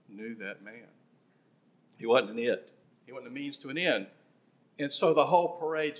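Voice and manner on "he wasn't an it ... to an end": usual speaking voice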